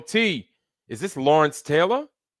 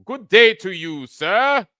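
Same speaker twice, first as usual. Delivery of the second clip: put-on louder, higher mid-Atlantic accent greeting; a snooty mid-Atlantic accent, tossed off quickly; mock mid-Atlantic accent; 7 semitones higher, 6 dB louder, slower than usual. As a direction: ham up an old-timey mid-Atlantic accent. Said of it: "with their mid-Atlantic accents".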